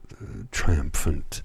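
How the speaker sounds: ordinary talk, nothing marked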